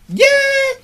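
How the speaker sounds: very high, loud mock cheer; full-volume squealed cheer; wave cheer. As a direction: burst into a pretend stadium "yay". Starts the wave out of nowhere.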